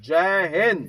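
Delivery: "जय Hind" shouted out loud, with the stress on "Hind"; shouted salute call. Shown as a demonstration. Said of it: "तीन बार बोलेंगे".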